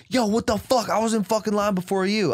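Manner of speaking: high, outraged yell; angry yell. Retold or imitated. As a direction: shout it like an offended stranger. Bodega guy angry at me.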